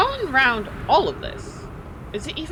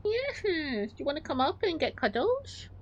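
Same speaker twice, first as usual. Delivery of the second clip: cooing pet talk; 7 semitones higher than usual. Real, not acted. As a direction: coax the cat up for cuddles. Invites cat for cuddles.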